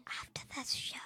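soft whispered aside; secretive whisper. Real, not acted. Answering a friend's secret, in a whisper. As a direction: whisper it confidentially.

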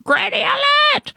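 very high, ringing rallying cry; crying out an alarm. Acted. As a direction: call the grannies to action, way up high. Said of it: "cried Mavis Jones".

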